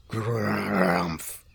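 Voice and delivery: low growling tummy noise; tummy rumble. A narrator's reading. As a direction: imitate a rumbling belly.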